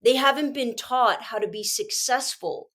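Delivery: usual speaking voice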